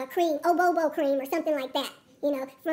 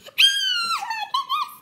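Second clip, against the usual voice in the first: much higher, louder squeal of delight; thrilled shout; 5 dB louder, much slower than usual. Real, not acted. Squealing with delight as she unboxes the item. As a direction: shriek with joy at the find.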